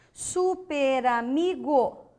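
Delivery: "Super amigo" slowly joined as su-pe-ra-mi-go; slow sounding-out. Shown as a demonstration. Teacher checking the corrected split, slow linked syllables. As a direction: stretch "Super amigo", the r opening "ra". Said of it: "Agora deu certo?".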